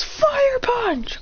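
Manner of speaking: high-pitched mock battle shout; attack call. Figure calls out his attack.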